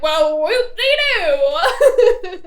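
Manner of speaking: loud, drawn-out mocking whoop; Sawyer. Sawyer answers the man's introduction, loudly mocking. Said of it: "and Sawyer's like".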